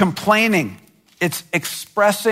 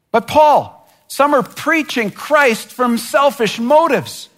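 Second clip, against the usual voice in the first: whiny complaint; 7 semitones higher than usual. Pretend. Church member complaining to Paul, higher and whining. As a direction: plead with Paul like an aggrieved believer. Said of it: "we could say, and Paul likely heard".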